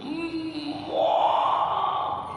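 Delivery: high, imitated baby wail bursting out; loud baby wail. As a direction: mimic a baby crying hard for milk. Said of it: "volumenya ditinggiin". (tiruan tangis bayi yang kencang dan melengking)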